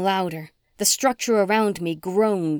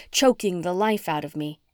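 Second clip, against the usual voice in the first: ordinary delivery, nothing marked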